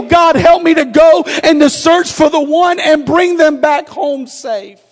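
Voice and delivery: loud, shouted pleading prayer; fervent prayer. Acted. Pastor praying aloud for the congregation, loud fervent plea. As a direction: cry out to God with full force.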